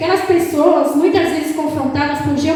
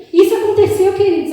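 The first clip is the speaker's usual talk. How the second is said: ordinary delivery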